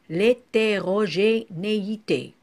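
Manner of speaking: "l'hétérogénéité" slow, each syllable marked out; slow syllabic "l'hétérogénéité". Shown as a demonstration. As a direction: spell out L'HÉTÉROGÉNÉITÉ in measured syllables. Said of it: "couper le mot par syllabe".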